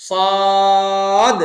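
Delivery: "সদ" held long on a level pitch, then d pushed; stretched, bounced Saad. Shown as a demonstration. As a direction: stretch "সদ" thickly and kick the ending. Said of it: "টান দিতে হবে এবং ধাক্কা দিতে হবে". ("সদ" লম্বা টান, শেষে ধাক্কা)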